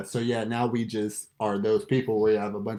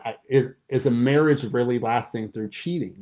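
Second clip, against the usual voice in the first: ordinary talk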